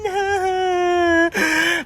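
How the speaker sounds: high crying wail. Acted. Ghost crying between wails.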